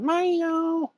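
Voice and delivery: high sing-song call of Milo; cheerful high pet call; calling the cat. Retold or imitated. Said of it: "you run around".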